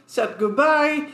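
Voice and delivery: usual speaking voice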